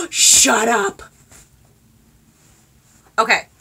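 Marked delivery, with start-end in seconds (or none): 0.0-1.0 gasp, then high, drawn-out exclamation; delighted disbelief. Real, not acted. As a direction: gasp and exclaim in happy shock. Astonished by what gift is.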